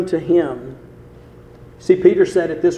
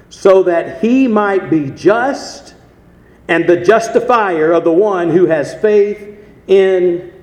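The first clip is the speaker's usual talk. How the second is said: preaching; 6 dB louder than usual. Acted. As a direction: proclaim each word to the church. Preaching scripture reading.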